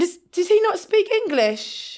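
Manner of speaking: high, slow, disbelieving ask; baffled question. Retold or imitated. Asking herself about her date, slow and high. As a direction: stretch it out in disbelief. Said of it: "I went".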